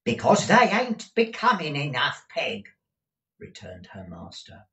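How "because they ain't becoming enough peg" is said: high, loud, impatient reply; raised voice. A narrator's reading. Gride answering deaf Peg, raised voice. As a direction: shout the answer at her. Master shouting to deaf housekeeper.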